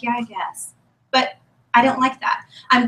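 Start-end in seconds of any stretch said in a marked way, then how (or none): 0.0-0.7 meek reluctant agreement. Retold or imitated. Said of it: "I'm like".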